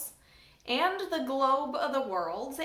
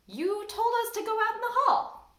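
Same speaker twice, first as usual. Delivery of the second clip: put-on innocent kid; 10 semitones higher, 4 dB louder than usual. A narrator's reading. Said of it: "just as if he were any ordinary kid".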